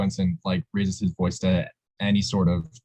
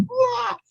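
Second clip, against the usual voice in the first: mock outburst. Mocking coordinator's outburst.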